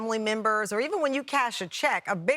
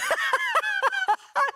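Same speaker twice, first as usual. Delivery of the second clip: high laughter. Real, not acted. Student breaking after trying the accent, high laugh. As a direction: crack up at your own attempt. Cracks up after own attempt.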